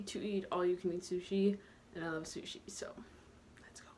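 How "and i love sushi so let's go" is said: whispering. Real, not acted. Whispered aside to camera.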